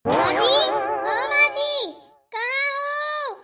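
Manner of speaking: high, drawn-out far-carrying call; loud calling out. Acted. Daughter-in-law searching for mother-in-law, loud calling out. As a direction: holler for her across the yard, stretching the words. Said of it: "इतनी तेज तेज बोल रहे थे".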